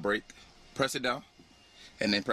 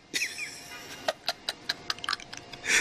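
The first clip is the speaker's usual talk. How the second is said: shocked laughter; 3 dB quieter than usual. Real, not acted. Laughs at her alarm.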